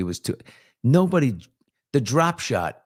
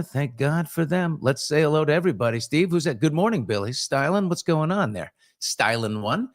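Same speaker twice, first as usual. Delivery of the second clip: normal talking voice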